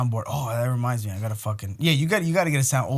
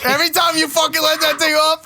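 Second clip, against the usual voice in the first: shouting; 7 semitones higher, 4 dB louder than usual. Real, not acted. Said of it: "I'm doing my samurai voice".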